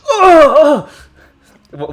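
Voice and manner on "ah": high loud yelp of pain; pained scream. Acted. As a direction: scream as the bullets hit. Bullets hit the Kagutsu.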